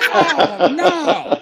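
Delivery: high, loud wail of dismay; hammed-up doomed wail; retold dismay. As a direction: cry out in comic mock horror. Retold reaction to jail threat.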